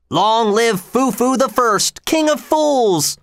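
very high, drawn-out jeering cry; mocking shout. A narrator's reading. Someone in the crowd ridicules Edward, high slow mocking shout. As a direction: stretch it into a slow, high mock proclamation. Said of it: "someone shouted".

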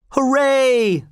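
very high, held hurrah; shouted cheer. A narrator's reading. Crowd seeing Tell arrive behind them, cheering. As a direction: cheer in a long high call. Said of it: "the crowd shouted".

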